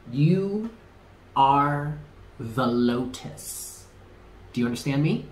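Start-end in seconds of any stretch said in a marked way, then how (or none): 0.1-3.8 very slow, drawn-out affirmation. Shown as a demonstration. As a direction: stretch out each word, pausing between them. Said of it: "Let's say that again".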